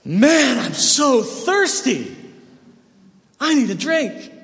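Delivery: put-on thirsty kid. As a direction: play a kid gasping for water. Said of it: "be like".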